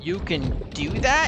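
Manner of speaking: surprised. Real, not acted. Surprised by enemy move.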